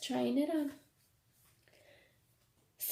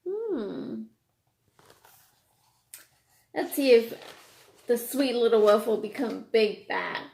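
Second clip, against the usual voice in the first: ordinary delivery, nothing marked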